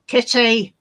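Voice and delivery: loud, sharp call; calling the cat. Real, not acted.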